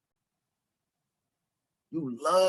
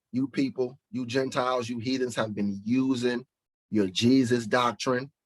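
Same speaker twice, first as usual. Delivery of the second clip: normal talking voice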